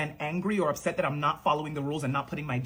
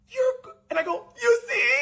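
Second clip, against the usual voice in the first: mock crying exclamations. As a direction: blurt it out, half sobbing. Teacher shocked, child crying.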